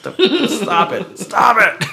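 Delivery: high, slow, laughing mock plea; silly clown. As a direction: whine it out like a clown. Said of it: "You're like".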